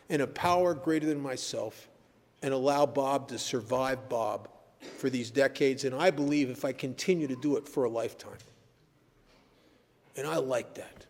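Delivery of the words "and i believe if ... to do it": ordinary delivery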